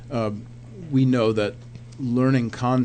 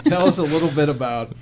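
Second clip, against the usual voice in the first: lifted, louder, through a laugh; amused, laughing lead-in to a question; 5 semitones higher, 8 dB louder than usual. Real, not acted. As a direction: ask it while still chuckling. Question after shared laughter.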